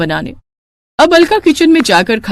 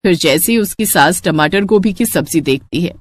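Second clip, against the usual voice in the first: ordinary delivery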